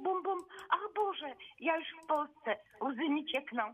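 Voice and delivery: ordinary delivery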